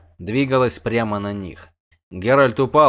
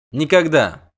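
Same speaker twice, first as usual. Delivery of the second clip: higher, louder flat-out refusal; sharp refusal; 5 semitones higher, 5 dB louder, slower than usual. A narrator's reading. Sorceress refuses the witcher's apology, sharp refusal. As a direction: refuse him forcefully.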